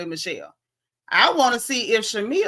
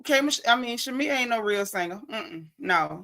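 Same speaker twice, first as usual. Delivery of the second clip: ordinary delivery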